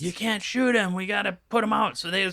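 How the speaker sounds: high-pitched imitation of an objector; high, put-on voice arguing without shouting; mock pleading argument. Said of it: "Greg starts fighting him over it".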